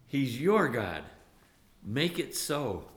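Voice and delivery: ordinary talk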